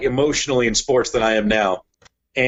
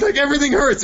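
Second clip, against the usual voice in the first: much higher, strained panicky whine; panicked whine; 12 semitones higher, faster than usual. Retold or imitated. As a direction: squeak it out like a scared kid who can't breathe. Retold panic, voice cracking high.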